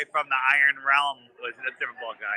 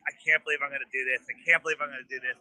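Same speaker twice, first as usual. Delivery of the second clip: rushed self-muttering; 3 semitones lower, much faster than usual. Retold or imitated. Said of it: "I'm saying it out loud".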